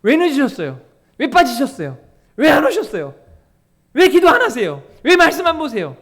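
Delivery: loud, nagging reproach building up; scolding questions. Acted. Pastor nagging members who skip church, building loud reproach. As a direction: press them with each question.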